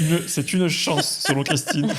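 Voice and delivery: slow, laughing teasing; co-host teasing the guest, laughing; laughing tease. Real, not acted. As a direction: draw it out, amused. Teasing the guest about her husband.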